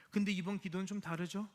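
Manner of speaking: ordinary delivery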